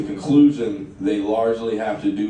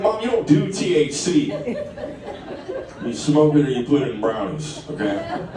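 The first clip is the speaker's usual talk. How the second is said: mock exasperated son, higher pitch; exasperated correction; 6 semitones higher than usual. Son corrects stoned mom, exasperated and laughing. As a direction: set mom straight, half-laughing.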